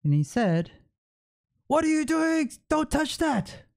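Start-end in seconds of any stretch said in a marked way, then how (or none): 1.7-3.6 high-pitched retold angry yell; condescending yelling. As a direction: mimic a man snapping condescendingly, pitched way up. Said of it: "started yelling at me condescendingly".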